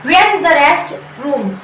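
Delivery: very slow and deliberate; slow teaching demo. Shown as a demonstration. Teacher modelling the sentence slowly for students. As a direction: lay it out slowly. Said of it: "आपको बोलना पड़ता है".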